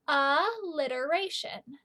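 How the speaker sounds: slow, spelled-out teaching pronunciation; slow syllable-by-syllable. Shown as a demonstration. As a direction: draw each syllable out slowly. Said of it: "Can you say it with me?".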